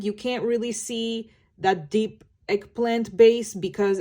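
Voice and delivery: usual speaking voice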